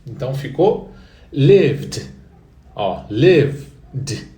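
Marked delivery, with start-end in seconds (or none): 1.3-4.3 "lived" said loud with a silent D at the end; "lived" loud, stressed at the start; model pronunciation. Shown as a demonstration. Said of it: "colocasse um D de dado mudo no final".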